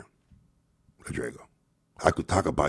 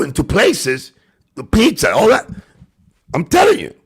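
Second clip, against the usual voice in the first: raised, heated voice all through; heated rant; 10 semitones higher, 5 dB louder than usual. Real, not acted. Ranting at Rodrigo over attacks, loud and heated. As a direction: rant louder and higher at the rival.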